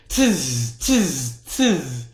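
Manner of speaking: monkey tsk. A narrator's reading. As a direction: click back at him like a monkey. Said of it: "and said".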